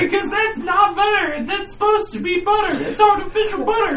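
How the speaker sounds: high mock explaining. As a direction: squeak the explanation then shout. High explaining, shouting end.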